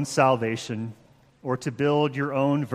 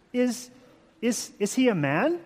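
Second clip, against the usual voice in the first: put-on young girl's hesitant question, high and soft, English accent; 6 semitones higher, 4 dB quieter than usual. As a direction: stammer it timidly in a mock English accent. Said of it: "my horrible English accent".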